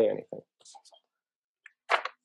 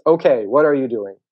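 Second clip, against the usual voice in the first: loud, higher projected question; calling out; 4 semitones higher, 9 dB louder than usual. Acted. Turtle now close but still loud, calling out. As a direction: keep hollering the question.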